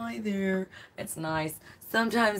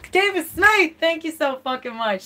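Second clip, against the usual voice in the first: yelled thanks, much higher and louder; shouting; 8 semitones higher, 8 dB louder than usual. Real, not acted. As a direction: shout the thanks at the top of your voice. Thanking a generous fan.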